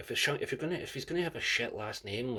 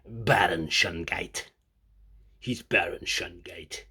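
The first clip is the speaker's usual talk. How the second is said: lower, slow, menacing villain voice; dramatic villain; 4 semitones lower, 4 dB louder, slower than usual. Pretend. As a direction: announce a sinister wrestler. Inventing villain names.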